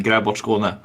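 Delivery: Gräv bort Skåne said the Swedish way, Skåne as SKOR-neh; Gräv bort Skåne in native Swedish; Swedish pronunciation. Real, not acted. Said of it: "in Swedish they call themselves".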